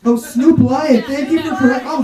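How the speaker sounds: Cosby lecturing Snoop; Bill Cosby impression. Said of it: "a conversation between Bill Cosby and [name]".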